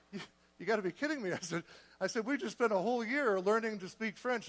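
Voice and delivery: retold incredulous reaction; comic disbelief. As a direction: blurt it in mock exasperation. Retold reaction to bad news.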